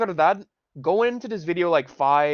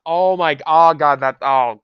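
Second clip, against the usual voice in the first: loud pained outburst; real pained outcry; pained groan; 10 dB louder than usual. Real, not acted. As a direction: cry out at the ringing. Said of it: "I hate that".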